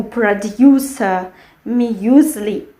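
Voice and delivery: producer and muesli slowed, with an E-U glide; English E-U glide. Shown as a demonstration. Teacher shows what not to write, English sound. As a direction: stretch "yu" in producer and muesli, pitch down. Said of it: "not this E U".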